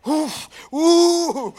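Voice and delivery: imitated high, drawn-out "ooh"; mock hype-up whooping; weird psych-up hoots. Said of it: "doing weird things".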